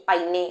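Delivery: পাইনে with a sounded e at the end; mistaken pronunciation demo. Shown as a demonstration. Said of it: "এরকম কিছু লাগিয়েছি".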